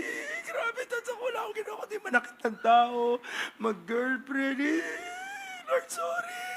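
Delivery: high, tearful voice breaking; crying prayer. Retold or imitated. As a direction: sob out the confession to God. Tearful confession prayer retold. (umiiyak na panalangin)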